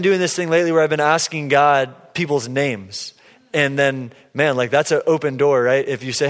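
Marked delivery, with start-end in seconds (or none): none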